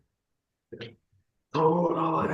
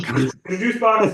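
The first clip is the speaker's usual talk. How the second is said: pet praise voice; 8 semitones higher, 5 dB louder than usual. Acted. Talking to the cat like a pet.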